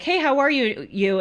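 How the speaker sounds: a hint of a cashier's routine hello; cashier greeting. Cashier greeting a customer at the till, lightly acted perky greeting. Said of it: "the cashier will be like".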